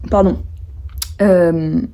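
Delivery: interrupted by a burp. Real, not acted. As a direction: burp mid-sentence, then excuse yourself. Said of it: "je me coupe en rotant".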